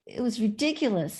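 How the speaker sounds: ordinary talk, nothing marked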